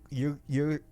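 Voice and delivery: ordinary delivery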